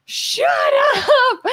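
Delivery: mock brotherly yell, up high; retold yell. Brothers shouting at singing little sister, mock yell. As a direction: bark it like an annoyed big brother. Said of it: "my brothers would yell".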